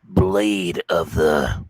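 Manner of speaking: very slow, deep trailer title; movie announcer voice. Pretend. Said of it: "movie announcer voice".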